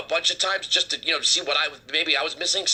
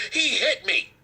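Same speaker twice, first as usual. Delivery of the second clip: mild mock whine of a kid telling on someone; whiny kid tattling; 4 semitones higher than usual. Host imitates bully crying to principal, mild mock whine. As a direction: lightly play the tattling child. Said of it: "starts crying to the principal".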